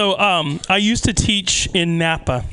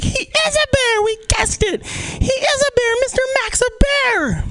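high, far mock chant of excited kids; a whole class of kids shouting they guessed it; kids cheering. Comedian voicing sixth graders cheering, high and shrill.

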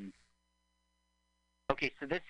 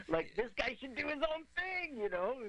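laughing; 12 semitones higher than usual. Real, not acted. Laughing about the villain.

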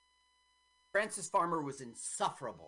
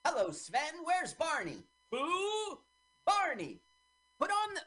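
high, loud, sing-song fake Swedish; hammed-up bad Swedish; mock Swedish accent; 12 semitones higher, 4 dB louder than usual. Mocking an actor's fake Swedish in a film, high sing-song.

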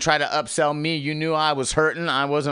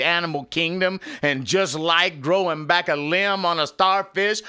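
rising higher into a sermon cadence; preaching; 6 semitones higher than usual. Acted. Telling how nerves grow back, rising into a sermon. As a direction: build it like a preacher.

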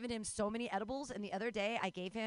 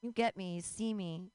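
ordinary talk

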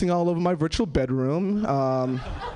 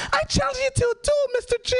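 high put-on child voice; imitated kid challenger; faster than usual. Pupil challenging his teacher to a wand duel, high mock bravado. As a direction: declare it like an overexcited little boy, very high.

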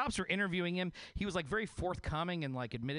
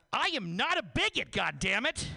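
loud, high-pitched indignant outburst; outraged denial shouted at the police; really upset; 7 semitones higher, 5 dB louder than usual. Acted. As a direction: blow up at the accusation. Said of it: "he got really upset with the police".

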